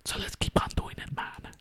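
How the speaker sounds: put-on hushed, Mexican-accented whisper; creepy Mexican whisper. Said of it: "creepy Mexican whisper boy".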